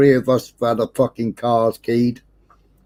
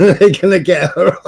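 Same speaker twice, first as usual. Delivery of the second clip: laughing; 6 semitones higher than usual. Retold or imitated. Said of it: "I said".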